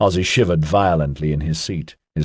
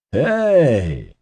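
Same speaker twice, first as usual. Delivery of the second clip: bright, higher happy greeting; cheerful hey, pitched up; happy exclaim; 6 semitones higher than usual. A narrator's reading. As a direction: call out glad to see him. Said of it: "Ozzy exclaimed happily".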